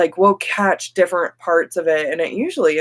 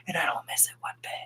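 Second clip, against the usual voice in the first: hushed whisper, a bit quick; 13 dB quieter, much faster than usual. Real, not acted. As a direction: drop to a secret whisper. Aside about leaving hair work.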